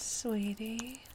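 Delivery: ordinary talk, nothing marked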